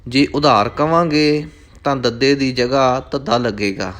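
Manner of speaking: normal talking voice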